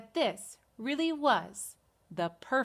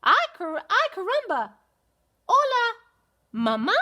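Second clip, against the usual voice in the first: very high, loud squeaky newborn chick; baby chick voice; 10 semitones higher, 6 dB louder than usual. Acted. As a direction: greet mama like a fresh chick.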